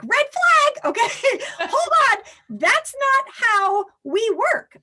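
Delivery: high, loud and laughing, slowing down on the last words; a playful red-flag alarm call; alarmed coach reaction. Retold or imitated. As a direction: sound the alarm like a coach catching a bad thought. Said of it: "as a coach, I'm like".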